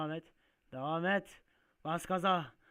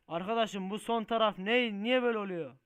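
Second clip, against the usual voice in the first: loud, high-pitched exasperation; exasperated protest; 6 semitones higher, 9 dB louder than usual. Real, not acted. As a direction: complain loudly at the game. Oyunda terslik, isyan ediyor. (yüksek sesle isyan ederek)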